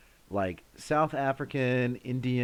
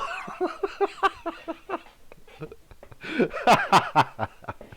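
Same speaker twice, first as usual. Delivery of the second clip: high laughing fit, renewed at the end; helpless long laugh, pitched high; real cracking up. Real, not acted. Streamer picturing Phil's letters, cracking up.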